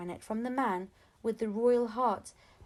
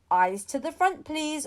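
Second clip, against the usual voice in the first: louder teacher order; 5 semitones higher, 6 dB louder than usual. A narrator's reading. Missus Khan orders the class to face front, louder. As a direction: command the class firmly. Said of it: "ordered Missus Khan".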